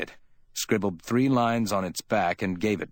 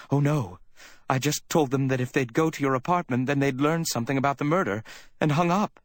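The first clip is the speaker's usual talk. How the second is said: high, quick woman's voice; defensive Iva; 8 semitones higher, faster than usual. A narrator's reading. Woman explaining her police call, defensive. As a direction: explain hurriedly in a raised pitch.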